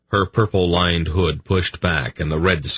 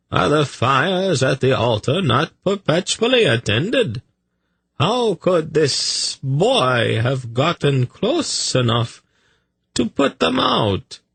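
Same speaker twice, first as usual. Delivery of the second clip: protesting accused; 10 semitones higher than usual. A narrator's reading. Tzadi challenging the accusation about altar fires, protesting. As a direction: question the charge in disbelief.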